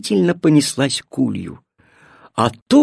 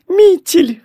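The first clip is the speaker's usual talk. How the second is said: very high, glad cry; delighted greeting; 14 semitones higher, 4 dB louder than usual. A narrator's reading. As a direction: grandmother overjoyed to see her granddaughter. Grandmother greets granddaughter joyfully. (радостный возглас бабушки)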